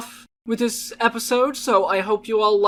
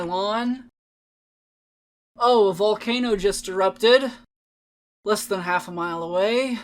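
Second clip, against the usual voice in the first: bored poem performance; bored child reading. Acted. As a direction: drag every line like nothing matters.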